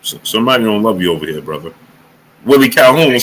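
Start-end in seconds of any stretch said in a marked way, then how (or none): 0.0-1.7 low, much softer, quick; mock whisper. Streamer pretending to whisper to Chisora, low and quick. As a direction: murmur it fast into his ear. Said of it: "I be wanting to whisper in Chisora's ear".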